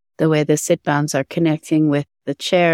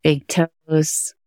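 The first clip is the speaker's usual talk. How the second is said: guided meditation. Acted. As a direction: guide attention gently through the body.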